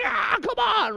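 high-pitched exasperated yell; mock frustrated scream. Substitute losing it with second graders, mock scream. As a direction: cry out like an adult reduced to a kid. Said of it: "I'm just screaming with them".

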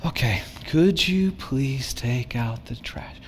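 soft, slow whispered ask. Retold or imitated. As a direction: make it gentle and breathy. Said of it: "Hey, that was better".

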